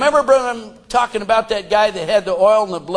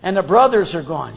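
usual speaking voice

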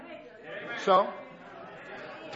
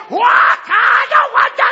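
mock whiny refusal; 13 semitones higher, 9 dB louder than usual.

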